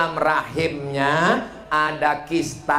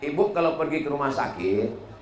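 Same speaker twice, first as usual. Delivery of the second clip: normal talking voice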